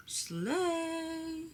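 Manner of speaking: high, soft drawn-out squeal; tiny high-pitched cheer; playful cheer. Real, not acted. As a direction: sing it out high and small.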